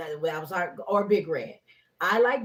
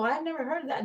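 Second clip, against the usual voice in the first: high, innocent surprise; feigned surprise; 8 semitones higher, faster than usual. Retold or imitated. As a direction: act surprised to a client. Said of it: "if I go".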